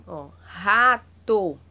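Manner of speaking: "rato" slow and loud, R long and strong; strong prolonged R. Shown as a demonstration. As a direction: stretch the R of "rato". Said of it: "Tem um som mais prolongado". ("rato" lento e forte, com R prolongado)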